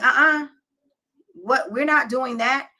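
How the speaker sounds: "uh-uh" like a no-nonsense mother; stern mom voice. Retold or imitated. Mother cutting off her emotional daughter, firm and dismissive.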